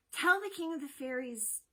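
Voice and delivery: high, slow, voiced urgent plea; hammed-up urgent son, not truly whispered; urgent whispering. A narrator's reading. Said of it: "whispering to his father urgently".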